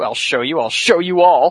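defiant outburst. Retold or imitated.

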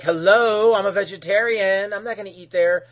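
imitating a huffy Beast Boy; snarky objection. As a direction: state the obvious with sass. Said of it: "And Beast Boy's like".

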